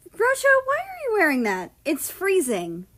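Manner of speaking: high, fast and loud, teasing; a lively young American calling out; America's voice. A narrator's reading. America asks Russia about his clothes, high and loud. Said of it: "America asked".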